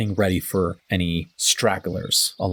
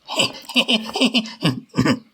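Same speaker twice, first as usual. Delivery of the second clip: mocking laugh; 11 semitones higher than usual. Half-orc laughs at stranger.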